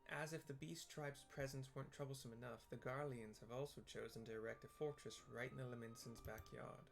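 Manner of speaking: ordinary talk, nothing marked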